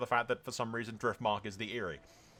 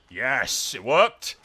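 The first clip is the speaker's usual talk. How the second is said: loud, rising cry of victory; triumphant cheer; 3 semitones higher, 10 dB louder, much slower than usual. Real, not acted. Player seeing the battle plan work, triumphant cheer.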